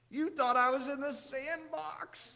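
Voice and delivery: slow, high, lightly voiced gleeful child; drawn-out, playful mock child voice; giggly five-year-old. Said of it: "He was giggling and laughing".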